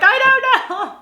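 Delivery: very high, loud comic wail; mock tearful wail. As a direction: wail it helplessly, as if crying for no reason. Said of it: "why I'm crying right now".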